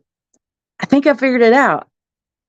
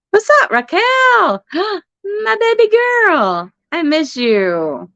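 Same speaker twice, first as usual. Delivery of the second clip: doting greeting; 9 semitones higher, much slower than usual. Real, not acted. Greeting a beloved viewer on stream.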